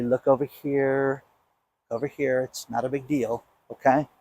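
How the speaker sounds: hushed, coaxing mockery; mock distraction. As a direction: lure them away, softly. Mocking a distraction trick.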